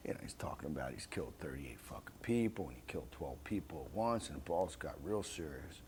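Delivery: whispered, confiding low; quietly confiding to the boss in a whisper; hushed whisper. Retold or imitated. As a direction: tell the boss in a secret whisper.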